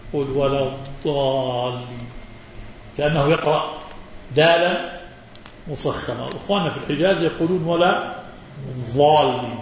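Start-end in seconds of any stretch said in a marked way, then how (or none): 0.1-2.1 الضالين with a heavy emphatic D; ḍād as emphatic dāl. Shown as a demonstration. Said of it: "يجعل الضاد دالا مفخمة". 8.7-9.6 الضالين with ض said like ظ; ḍād as ẓā'. Shown as a demonstration. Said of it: "يجعلونها ضاء مشالة".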